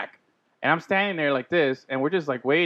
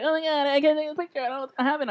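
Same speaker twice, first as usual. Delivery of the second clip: flustered fan; 11 semitones higher, 4 dB quieter, faster than usual. Retold or imitated. Fan's phone failing for a picture.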